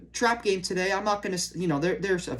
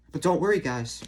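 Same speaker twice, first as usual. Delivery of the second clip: sarcastic; 6 semitones lower, 6 dB quieter than usual. Acted. Sarcastic praise after bad losses.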